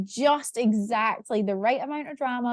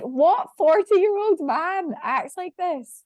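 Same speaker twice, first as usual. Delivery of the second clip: incredulous; 8 semitones higher, 4 dB louder, slower than usual. Retold or imitated. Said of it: "I'm like".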